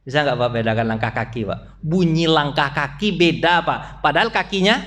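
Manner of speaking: normal talking voice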